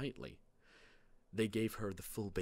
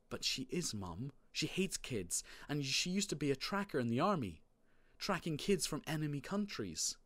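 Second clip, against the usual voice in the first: put-on higher-pitched, earnest kid voice; a kid pleading the case; insistent child Marty; 6 semitones higher than usual. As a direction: protest to mum, pitched up.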